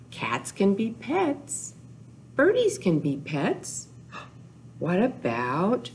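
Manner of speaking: ordinary talk, nothing marked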